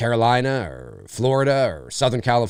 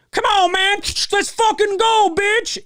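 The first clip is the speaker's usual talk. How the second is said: very high, loud mock hype; hammed-up American bro hollering way up high; brash American bro; 4 dB louder, slower than usual.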